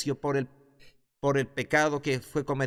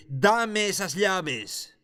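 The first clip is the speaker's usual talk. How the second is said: higher, very slow stern command; slow, commanding demand; 6 semitones higher, much slower than usual. Acted. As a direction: hand them over, drawn out. Jesús exige las llaves. (Jesús exige las llaves a Satanás, lento y firme)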